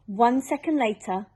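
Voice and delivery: plain reading voice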